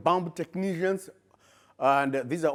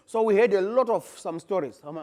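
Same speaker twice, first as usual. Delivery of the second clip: ordinary delivery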